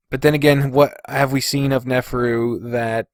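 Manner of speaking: ordinary talk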